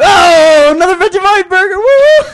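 gleeful high squeal; rising, delighted shriek of glee; excited. Real, not acted. Teasing friend about eating Vegemite.

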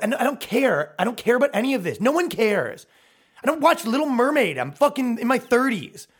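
high-pitched exasperated protest. Acted. Man answering accusations over things he ignores, exasperated.

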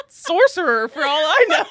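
loud, high words bursting through real laughter; helpless laughter. Real, not acted. Arguing over a name's pronunciation, laughing helplessly. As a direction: argue the point while cracking up. Both hosts in a laughing fit.

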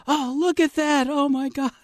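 very high gush of amazement; imitated awestruck rafter. Guide imitating Jim's reply to a worried boatman, awestruck. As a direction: marvel in a high, put-on voice. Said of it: "he'd just scream".

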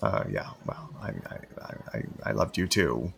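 flustered mumble. Acted. Caught off guard by ex.

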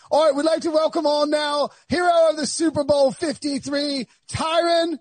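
high-pitched British TV host introduction; Trevor Noah. Acted. Host imitating Trevor Noah welcoming a guest, mock British announcer. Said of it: "Trevor Noah is doing the day show. He's like".

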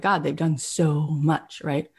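normal talking voice

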